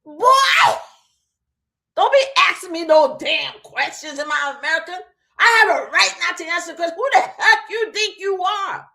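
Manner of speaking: put-on angry southern accent, shouted high and loud; southern MAGA man. MAGA man at his car window shouting at an ICE agent in a southern accent. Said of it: "my maga brothers and sisters with your southern accents".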